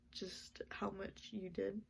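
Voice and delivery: breaking into a whisper through tears; tearful, whispered thanks. Real, not acted. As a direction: barely get it out, choked up. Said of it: "no, I cried".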